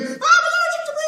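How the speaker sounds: mock panic; panicked thought. [name] thinking, mock alarm about bees. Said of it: "And I'm thinking".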